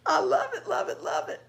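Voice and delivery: high, laughing glee; gleeful. Real, not acted. Host loving the clever trick, gleeful and laughing. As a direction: tumble the repeats out through laughter. Delighted by the prank.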